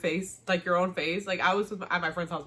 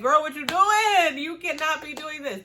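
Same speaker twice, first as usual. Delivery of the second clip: sassy scolding; 9 semitones higher, 7 dB louder than usual. Retold or imitated. Eloise scolding Penelope about the engagement, sassy and loud. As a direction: drag it out like a scandalized friend.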